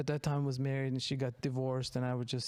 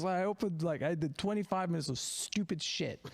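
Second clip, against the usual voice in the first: usual speaking voice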